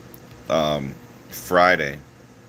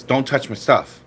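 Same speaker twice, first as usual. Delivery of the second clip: higher, louder put-on snappy voice; snappy coworker; 7 semitones higher, 5 dB louder than usual. Quoting the social worker telling him off, mock snappy. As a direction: mimic her snapping at him, pitched up.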